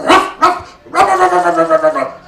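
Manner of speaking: loud gruff barking ruff ruff; dog-like barks. Retold or imitated. Hawke barking angrily at the waitress, loud barks. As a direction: bark it out like an angry dog. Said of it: "barks at her".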